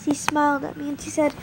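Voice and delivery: plain reading voice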